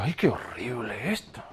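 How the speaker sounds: mucho más suave, horrorizado; espanto en voz baja. Acted. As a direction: retrocede con asco y susto. Horror ante el museo. (el creyente ve su pecado y se espanta)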